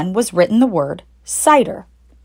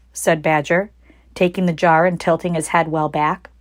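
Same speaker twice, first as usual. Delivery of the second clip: plain reading voice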